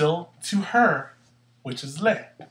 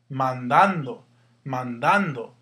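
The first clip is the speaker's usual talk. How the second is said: "Mandando" said man-DAN-do, DAN louder; stress on middle syllable; 4 dB louder, slower than usual. Shown as a demonstration. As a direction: punch the second syllable of "Mandando". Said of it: "That's where your stress is".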